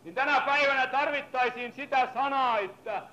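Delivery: fiery preaching. Acted. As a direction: preach it hard to wake the congregation. Preaching after scripture reading.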